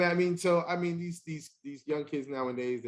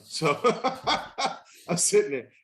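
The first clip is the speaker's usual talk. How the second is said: higher, louder, laughing through the words; 5 semitones higher, 4 dB louder than usual. Real, not acted. Telling the tree-limb story to the host, laughing. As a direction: crack up while telling it.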